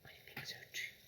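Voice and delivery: soft unvoiced whisper. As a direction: agree in a secret whisper. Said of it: "Lavender whispered back".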